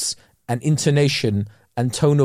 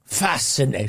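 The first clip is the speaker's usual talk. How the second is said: mock posh callback; 2 semitones lower, 4 dB quieter than usual. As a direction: slip into the put-on posh accent.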